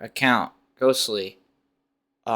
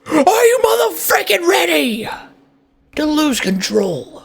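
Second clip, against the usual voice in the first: hype shout. Acted. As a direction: stretch it like a ring announcer. Hyping up before a match.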